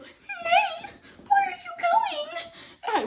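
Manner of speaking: very high, whiny falsetto, a little cracked; whiny girlfriend. Retold or imitated. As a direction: wail his name up high like she's desperate. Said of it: "But here she goes".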